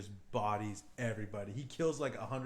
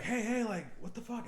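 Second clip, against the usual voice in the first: alarmed protest; 11 semitones higher than usual. Retold or imitated. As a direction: act out a stranded man's baffled protest. Acted guy stranded on drifting boat.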